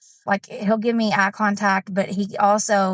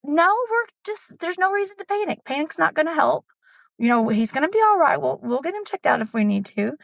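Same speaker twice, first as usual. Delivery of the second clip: calm husband; 7 semitones higher than usual. Retold or imitated. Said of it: "And he says".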